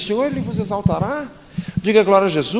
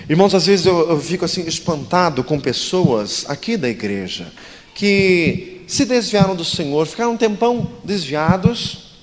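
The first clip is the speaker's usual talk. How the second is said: ordinary talk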